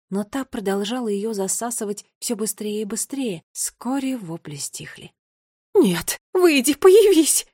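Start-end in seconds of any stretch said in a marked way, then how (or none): 5.7-7.5 high, loud, drawn-out plea; desperate exclamation. A narrator's reading. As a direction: cry out, rising to a shriek. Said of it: "воскликнула Гвардиния". (отчаянный громкий возглас)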